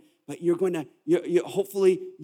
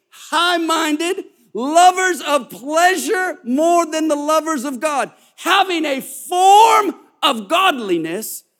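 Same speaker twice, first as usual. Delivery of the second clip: high, loud preacher reading scripture, slowed; preaching at full force; 8 semitones higher, 6 dB louder than usual. Acted. Pastor preaches the verses to the church at full force.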